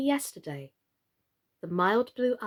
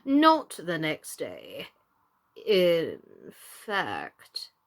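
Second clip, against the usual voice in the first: getting lower; 5 semitones lower, much slower than usual. A narrator's reading. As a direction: lower and slower, sinking. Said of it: "his voice getting lower and lower".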